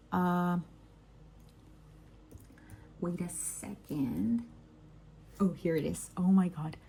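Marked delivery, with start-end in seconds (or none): none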